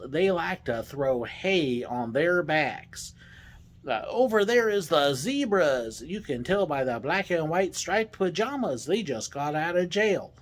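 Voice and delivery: hammed-up, drawn-out Texas drawl. Driver showing animals to passengers, Texas drawl. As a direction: play a tour guide with a put-on Texas accent. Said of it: "do the whole ride in my Texas drawl".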